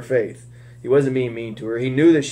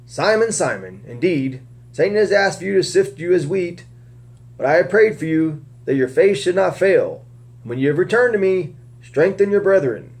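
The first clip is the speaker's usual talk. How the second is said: raised, earnest reading voice; scripture reading; 5 semitones higher than usual. Acted. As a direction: read the Gospel story aloud to the group. Reading the Lord's words.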